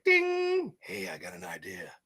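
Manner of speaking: a goofy put-on buddy voice; Animal impression. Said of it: "That's my Animal impression".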